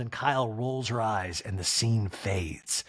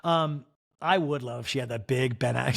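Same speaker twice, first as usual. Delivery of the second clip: ordinary talk, nothing marked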